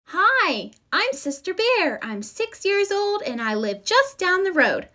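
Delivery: put-on high, bright little girl voice; lightly voiced cheerful cub; young cub girl. A narrator's reading. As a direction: play a six-year-old bear cub. Sister Bear voiced in story.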